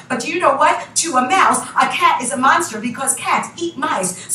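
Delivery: plain reading voice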